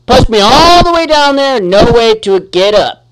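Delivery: loud, high aggrieved grumble; exasperated rant. Real, not acted. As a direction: vent at the unfair game. Complaining after being knocked down.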